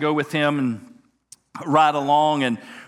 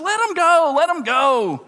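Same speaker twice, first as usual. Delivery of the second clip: excited order; 9 semitones higher, 3 dB louder than usual. Retold or imitated. Eager rookie giving orders.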